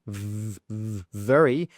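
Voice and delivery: "very" with a stressed V; clear V sound. Shown as a demonstration. Teacher modelling a clear V sound. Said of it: "instead of saying very".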